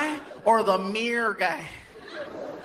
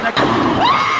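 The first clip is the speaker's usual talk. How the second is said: loud shout; 8 dB louder than usual. Retold or imitated. Comic yells the punchline to the crowd, loud shout.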